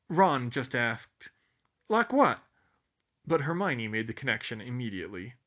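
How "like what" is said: put-on puzzled boy voice. A narrator's reading. Ron asking loudly.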